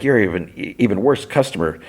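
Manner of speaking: normal talking voice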